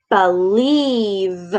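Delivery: "Believe" drawn out onto a long buzzing v; slow "Believe" ending in vvv; final V held. Shown as a demonstration. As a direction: stretch the last sound of "Believe". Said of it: "right at the end".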